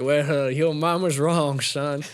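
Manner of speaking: slow, lightly put-on professor voice; Colonel Sanders. Professor correcting student after class chuckles, slow mild put-down. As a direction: correct him slowly and condescendingly. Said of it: "they're like".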